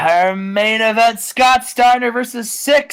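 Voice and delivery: slow, high, drawn-out match announcement; bill it big like a fight announcer; stretched-out main event introduction; ring announcer. Pretend.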